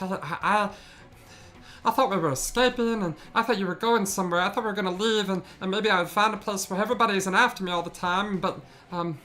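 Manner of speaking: high, trembling apology from a tiny goblin; scared little goblin. Acted. Goblin apologizing to the party, high and scared. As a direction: beg forgiveness, frightened and small. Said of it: "just scared".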